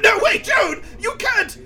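high, loud desperate protest; panicked shout. Acted. Time traveller stopping Joan's execution, panicked shout. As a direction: shout to stop her right now.

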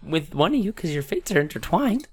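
high, singsong fortune-teller voice; lilting, swooping mystic crone. Acted. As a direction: pitch it up like an old fortune teller. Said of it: "She's gonna say".

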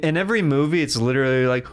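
normal talking voice

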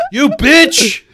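put-on high, loud kid insult; bratty kid. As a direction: spit it at mom. Kid answering his mother.

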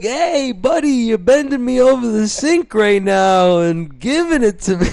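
high, loud, hammed-up Italian road rage; yelling Italian guy. Closeted Italian driver yelling at people, comic accented rage. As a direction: yell indignantly in a mock-Italian accent. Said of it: "while he's yelling at people".

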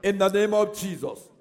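loud, driving prayer at full force; fervent prayer. Acted. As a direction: command it in Jesus' name. Preacher leads loud fervent prayer.

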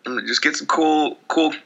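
high, mock-chirpy fan voice; sarcastic fan griping about merch costs, high and sing-song; sarcastic cheer. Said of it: "It's like".

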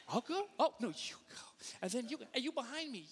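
high, mock-friendly dithering; over-polite deferring. Missouri driver making friends at the stop, over-polite. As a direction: hand the turn to everyone, sweetly. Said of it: "you're there to make friends".

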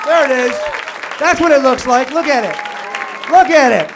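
loud, high mock-awed gawking at the guest. Real, not acted. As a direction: hoot at the guest like a zoo animal.

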